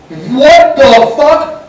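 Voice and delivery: loud, slow angry shout; angry yell. Retold or imitated. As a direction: bellow it out like a furious coach. Coach yelling in the story.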